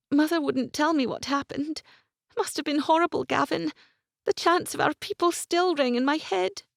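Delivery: put-on high, anxious young woman, shaken; young woman's voice. A narrator's reading. Isabel voiced, distressed.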